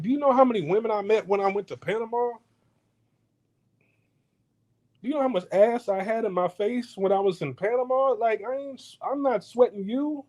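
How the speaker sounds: high, fast bragging; boastful rant. Retold or imitated. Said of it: "I'm like".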